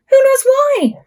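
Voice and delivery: playful suspense; excited suspense. Acted. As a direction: tease the children with it. Suspense refrain.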